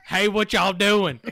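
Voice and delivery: slow, loud Southern greeting, played up; Southern accent. Gamer greeting teammates in his Southern accent. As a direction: lay the Southern twang on thick. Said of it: "talking shit about my accent".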